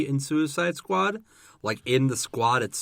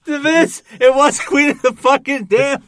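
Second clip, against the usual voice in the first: much higher, loud insistence; excited yell; 14 semitones higher, 10 dB louder than usual. Real, not acted. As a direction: shout it at your buddy, thrilled.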